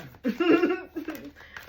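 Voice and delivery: big burst of high laughter; loud laugh. Real, not acted. Tarot reader cracking up at her own joke, loud laugh. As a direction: let a loud cackle break out.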